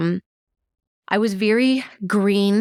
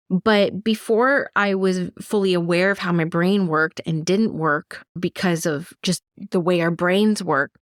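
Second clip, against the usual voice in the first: normal talking voice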